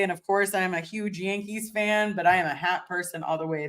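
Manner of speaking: normal talking voice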